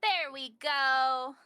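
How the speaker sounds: loud and slow, drawn out; slow loud triumph. Real, not acted. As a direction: stretch it out loudly, pleased. Repeats phrase, second louder.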